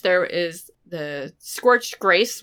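ordinary delivery

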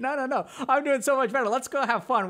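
chirpy partner pushing to go play; eager partner. Retold or imitated. Boyfriend dodging the hard talk, eagerly upbeat.

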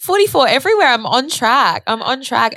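building delight at the sign; excited. Retold or imitated. Growing excitement, retelling seeing forty-four everywhere. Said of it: "I'm like".

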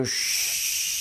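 unvoiced hiss of hot water. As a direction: mimic water rushing from the pipe. Hot water noise imitation. (siseo de agua caliente)

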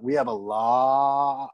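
very slow, stretched we have a lot; weird halting line. Retold or imitated. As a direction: drag out each word while searching for the line. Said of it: "That's why I went".

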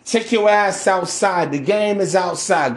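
usual speaking voice

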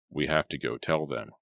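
usual speaking voice

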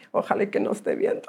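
fast, whispered, half-joking; whispered aside. Real, not acted. Hoping her old boss isn't watching, whispered aside. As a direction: whisper it like he might hear.

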